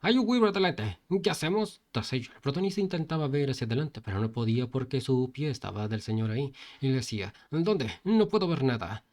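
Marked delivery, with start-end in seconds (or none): 0.0-1.8 high, loud alarmed shout; shouting warning. Acted. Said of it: "el señor le gritó". 7.5-9.1 panicked shout. Acted. Panic on the bike.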